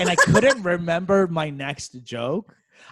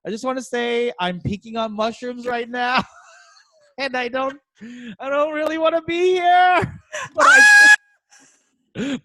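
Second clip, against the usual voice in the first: high, strained nervous confession with laughter; freaking out; 9 semitones higher, 5 dB louder than usual. Retold or imitated. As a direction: reenact panicking on stage, pitch climbing. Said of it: "I'm, like, freaking out".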